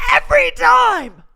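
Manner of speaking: loud exasperated repeat. Real, not acted. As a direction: hammer it home in disbelief. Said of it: "exasperated emphasis".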